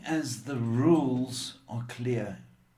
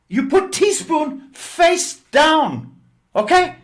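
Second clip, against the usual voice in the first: high, loud, slow broken-English command; mock Chinese accent; 14 dB louder than usual. Landlady insisting to lodger on teaspoon rule, slow loud mock accent. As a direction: spell out the rule slowly in the put-on accent, much higher and louder. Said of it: "that's how she talked".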